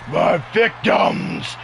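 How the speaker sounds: mock villain voice savouring the words; Reptile impression. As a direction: stretch it out like a sinister wrestler. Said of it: "That's how he says it".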